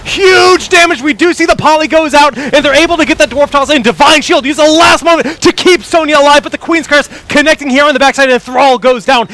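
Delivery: high, loud, full-force esports call; excited play-by-play. Real, not acted. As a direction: ride the team fight at peak excitement. Live call of team fight.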